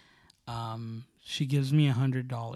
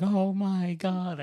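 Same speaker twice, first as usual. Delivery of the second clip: freaked out; 6 semitones higher, much slower than usual. Retold or imitated. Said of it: "I freaked out even more".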